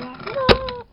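high, loud yelp of protest; shouted no. Acted. A toy shoved on the ice yelps in high, loud protest.

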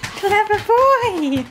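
slow, high, laughing praise for the dog; praising the dog for bringing the treat ball, high and slow. Real, not acted. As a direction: gush over the clever dog with a laugh. Praising dog after fetch.

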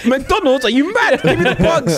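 high, laughing greedy demand; excited kid voice. Pretend. Mock greedy kid demanding bugs.

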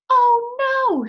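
high, loud cry of alarm; alarmed exclamation. Acted. Hen hears a stampede, cries out in alarm. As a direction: squawk it out in sudden fright. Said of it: "she exclaimed".

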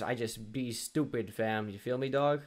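a mock street-slang voice, lightly done; put-on slang voice. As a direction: toss it off in a borrowed bro voice. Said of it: "I don't talk like that".

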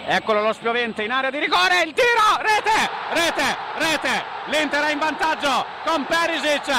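breathless italian goal commentary. Acted. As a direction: explode on the goal. Goal shout. (urlo del gol)

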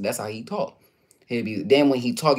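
ordinary talk, nothing marked

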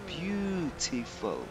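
best British accent. Shown as a demonstration. Teaching viewers to repeat it, slow British accent. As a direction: draw out "Beautiful" in careful British English. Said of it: "in your best British accent".

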